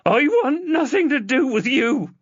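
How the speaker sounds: high, loud bitter yell; girl disowning her father; angry rejection. Acted. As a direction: cut him off for good. Chloe glares, rejecting him.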